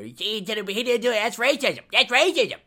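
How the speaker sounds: high, loud mocking outcry; mock outraged student. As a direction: mimic a shrill protester crying racism. Mocking a studies major's outcry.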